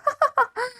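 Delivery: high mocking cackle; gleeful dinosaur laugh. A narrator's reading. Sid laughing at Spike covered in berries. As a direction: laugh at the mess you made. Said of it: "Laughed Sid".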